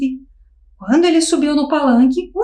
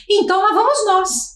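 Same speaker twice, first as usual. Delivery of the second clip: shouted together; 5 semitones higher than usual. A narrator's reading. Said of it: "gritaram todos".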